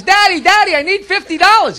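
put-on high, eager little-girl plea; pleading daughter. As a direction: beg daddy in a high voice.

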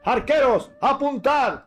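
orden a los arqueros. Acted.

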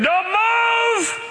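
sudden high mock parent yell; parent screaming. Parent in the car yelling at the child, high mock scream. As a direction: shriek it like a panicked parent. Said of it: "They start screaming".

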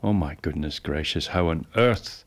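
ordinary talk

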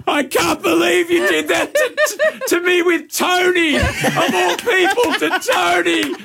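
staged scorned-partner shout in a car park; mock jilted yell. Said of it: "I'm going to yell out and say that you cheated on me".

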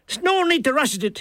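high Irish character voice; lazy Milligan. A narrator's reading. Milligan lies down again excusing himself, high character voice.

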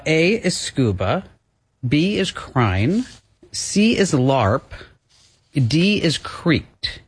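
slow, deliberate answer options; slow quiz-host read. Acted. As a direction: drag out each option like a game show.

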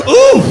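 loud, very high-pitched yelp; recoiling "ooh". Retold or imitated. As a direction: react to a sip that is too strong.

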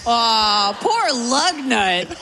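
high, slow, pitying coo. Pretend. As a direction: coo it like over a baby bird. Pitying a baby bird.

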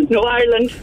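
put-on short Irish-accented correction; Irish caller setting them straight. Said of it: "she's putting on an accent".

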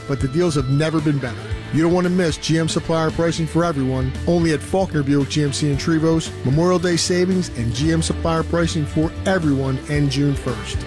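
normal talking voice

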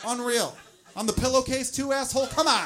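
loud, high, disbelieving rant; hammed-up fury at the mess; mock outrage. Ranting at the load.

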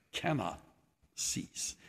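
hushed, drawn-out repeat; soft repeat. Acted. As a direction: let the words fall to a hush. Preacher repeats phrase softly.

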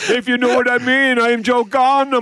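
put-on West Indian accent. Host mimics a West Indian cricketer for laughs, hammed-up Jamaican accent. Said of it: "Bit of [name]".

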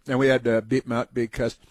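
ordinary delivery

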